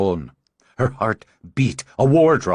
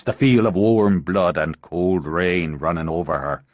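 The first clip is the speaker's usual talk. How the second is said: plain reading voice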